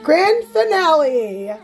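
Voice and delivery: slow, high, drawn-out reveal; showy announcement. Pretend. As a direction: announce it big, stretching the words out.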